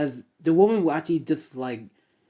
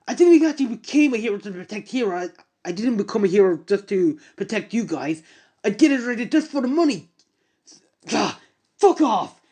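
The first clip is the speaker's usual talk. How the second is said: contemptuous rant; 5 semitones higher than usual. Acted. Greedy hero tells her off, high sneering rant. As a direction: spit out your selfish truth. Hero admits he's in it for money.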